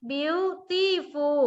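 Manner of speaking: beautiful stretched very slowly, syllable by syllable; counting out syllables. Shown as a demonstration. Teacher to students counting syllables, beautiful drawn out very slowly. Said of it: "beautiful มีกี่พยางค์คะ".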